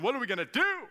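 high, loud mock panic; freaking out. Preacher imagining Paul and Silas in jail, mock panicked. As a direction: wail it in hammed-up alarm. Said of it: "Were they freaking out?".

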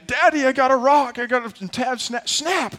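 high pretend little-boy voice; high, hammed-up child; excited young son. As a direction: imitate a kid calling dad about a snag. Voices sons fishing and snagging lines.

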